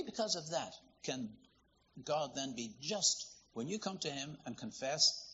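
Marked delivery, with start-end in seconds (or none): none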